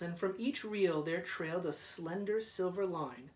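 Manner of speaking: usual speaking voice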